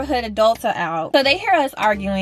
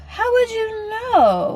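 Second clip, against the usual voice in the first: much higher, stressing would; high mocking repeat; 12 semitones higher, slower than usual. Shown as a demonstration. Repeating her comeback, high and mocking. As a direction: squeal it back in disbelief. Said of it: "repeats the question high".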